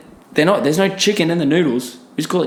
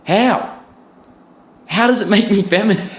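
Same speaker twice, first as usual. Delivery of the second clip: pitched up, disbelieving question; higher, baffled and indignant; incredulous retort; 7 semitones higher, 3 dB louder than usual. Real, not acted. Vlogger retorting to the soy critics, pitched up and incredulous.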